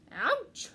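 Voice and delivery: high loud ouch; pained yelp. A narrator's reading. Ratty pricked under his pile, high loud yelp.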